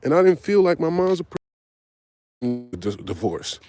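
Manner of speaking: ordinary talk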